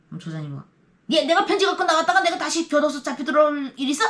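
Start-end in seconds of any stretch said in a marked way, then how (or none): 1.1-4.0 high, loud grumbling retort; grumbling team leader. Retold or imitated. Team leader grumbling at a prisoner, loud and high. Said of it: "겉으로 막 투덜대는 사람들".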